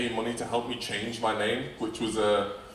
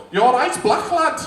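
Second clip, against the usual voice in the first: slow, much higher mock Scouse greeting; Scouse accent; 8 dB louder, slower than usual. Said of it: "the Scouse accent".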